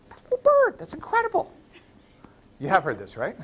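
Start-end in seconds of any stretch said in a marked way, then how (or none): 0.1-1.5 very high, hammed-up amazement; amazed. Lady watching the bird smash the chair, amazed. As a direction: squeal it like an astonished customer.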